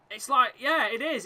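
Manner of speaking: ordinary talk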